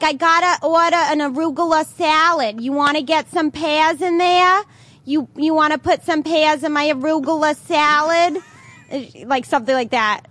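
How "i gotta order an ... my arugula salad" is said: broad Long Island accent, acted out. Woman ordering salad from a cartoon script, Long Island accent. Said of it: "I could do a Long Island accent".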